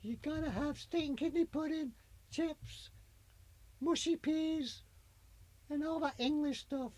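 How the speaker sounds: put-on high, posh English lady accent. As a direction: play the English queen, high. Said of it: "If I talk like this".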